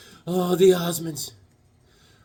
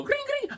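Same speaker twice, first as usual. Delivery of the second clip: high squeaky gring gring; phone ring imitation; 7 dB quieter than usual. Caller imitating a phone ring, high and squeaky. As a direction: mimic a phone ringing. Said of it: "I go".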